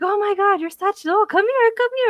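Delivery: high, loud sugary call to the dog; fake sweet coaxing. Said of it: "You're like".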